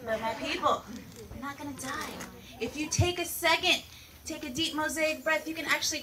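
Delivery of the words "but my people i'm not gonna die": calm reassurance. Acted. Calming after mock panic.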